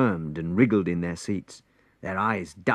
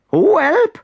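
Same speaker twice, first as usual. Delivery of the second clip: very high, loud shriek of fright; frightened cry; 11 dB louder than usual. A narrator's reading. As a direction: yelp and bolt. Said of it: "cried someone and ran away".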